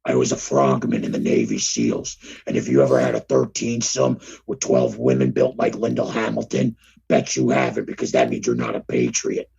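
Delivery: very low, gravelly-free deep rumble; Jesse Ventura impression. Playing Jesse Ventura for cohost, very low voice. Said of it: "advertisement for the episode as Jesse the Body".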